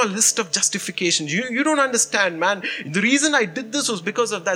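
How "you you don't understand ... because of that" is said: defensive justifying. Retold or imitated. Pastor retelling his excuses to his wife, heated and defensive. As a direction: argue your case, worked up.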